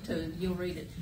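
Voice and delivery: ordinary talk, nothing marked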